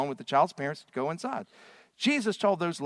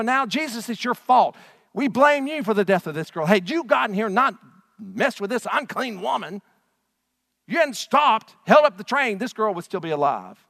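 loud, higher accusing outcry; crowd blaming Jesus; 7 semitones higher, 8 dB louder than usual. Retold or imitated. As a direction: point the finger and shout the blame at him. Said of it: "And they go".

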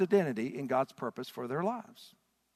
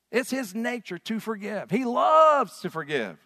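building emphasis; 6 semitones higher, 6 dB louder than usual. Acted. Rising emphasis.

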